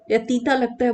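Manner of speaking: ordinary talk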